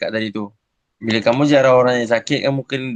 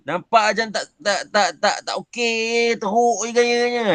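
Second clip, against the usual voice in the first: rude tone; 9 semitones higher than usual. Shown as a demonstration. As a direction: sneer that the teacher looks unwell. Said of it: "itu bahasa yang kasar".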